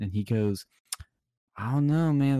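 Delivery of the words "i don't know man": louder, dismissive I don't know man; louder dismissive caller. Retold or imitated.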